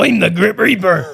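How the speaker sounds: a hint of a put-on Reaper accent; lightly voiced Grim Reaper. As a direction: announce yourself as the Reaper. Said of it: "he's got that accent".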